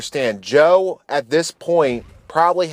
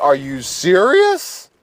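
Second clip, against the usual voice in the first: slow, higher-pitched mock disbelief; dumbfounded; 5 semitones higher, much slower than usual. Friends staring at the culprit Joe, slow mock-dumbfounded question. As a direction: draw out the incredulous question, acting stunned. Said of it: "had the dumbfounded deer in the".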